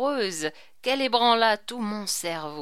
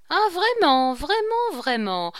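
mocking disbelief; 6 semitones higher, 4 dB louder than usual. Chicot mocks the king's tale.

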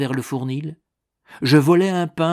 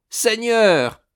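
"Seigneur" flung out much higher and louder; crying out; 10 semitones higher, 6 dB louder than usual. A narrator's reading. Old Rosa crying out to God in relief, much higher and louder. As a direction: burst out to heaven. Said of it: "cria-t-elle".

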